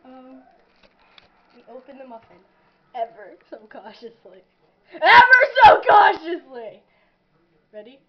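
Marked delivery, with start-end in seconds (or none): 4.9-6.8 loud mock dramatic yell; shouted. Shown as a demonstration. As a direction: belt it out theatrically. Repeat shouted.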